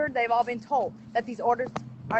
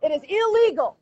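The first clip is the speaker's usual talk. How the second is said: raised voice; 3 semitones higher, 3 dB louder than usual. Real, not acted. Mother ordering them to let go of her son, voice raised. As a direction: voice jumps up, ordering them to stop. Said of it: "Yes, my voice went up".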